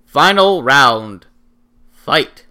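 loud, slow announcer call; booming fighting game announcer. Acted. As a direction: boom it out like an arcade announcer. Announces the final round.

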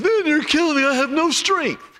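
high, loud mock voice of a man laughing too hard; imitating a prankster cracking up. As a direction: gasp it out like you're weak from laughter. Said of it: "the guy starts laughing and he says".

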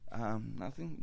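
very soft, low sheepish mumble; sheepish reply. Acted. Audience member caught talking by the comic, sheepish. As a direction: answer small and quiet, caught out.

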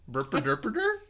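a hint of garbled monster babble; creature gibberish. Grimace babbling nonsense at the giant balloon. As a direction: grunt the nonsense words. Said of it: "He is so upset".

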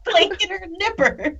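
high squeaky lobster voice; lobster voice with claw clacks. Pretend. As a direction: play a lobster clacking its nippers. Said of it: "What was that?".

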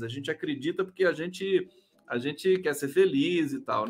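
usual speaking voice

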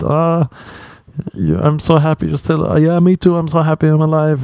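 high, gushing, fake-grateful voice; mock gushing survivor. Comic mocking a social post, in a high gushing voice. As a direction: overdo the relief sarcastically. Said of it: "their post is just".

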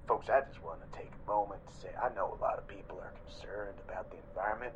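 hushed, low sales pitch; confidential whisper. Acted. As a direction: lean in and whisper to customers. Ad owner turns confidential.

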